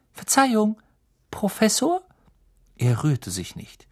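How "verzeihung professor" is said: high, polite, tentative call; apologetic, gently rising question; cautious. A narrator's reading. Hermine tries waking the sleeping professor, politely tentative. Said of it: "näherte sich vorsichtig".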